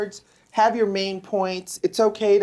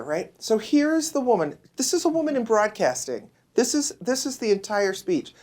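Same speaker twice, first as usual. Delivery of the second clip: ordinary talk, nothing marked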